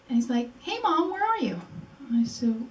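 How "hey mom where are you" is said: high, easy phone greeting; casual, unalarmed. Retold or imitated. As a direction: imitate a son calling as if nothing is wrong. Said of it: "there was nothing in his voice to alarm me".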